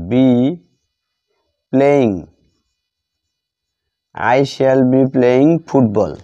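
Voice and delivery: ordinary talk, nothing marked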